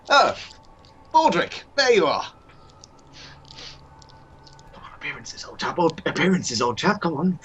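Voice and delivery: lightly voiced posh master; a hint of a jolly old gentleman; master character. Master greeting his servant Baldric.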